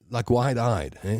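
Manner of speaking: usual speaking voice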